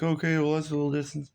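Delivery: a touch of irritated sarcasm about the extra drive; sarcastic aside. Retold or imitated. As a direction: let the annoyance leak through a polite okay. His own annoyed reaction retold.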